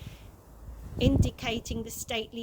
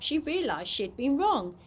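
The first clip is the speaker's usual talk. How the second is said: ordinary delivery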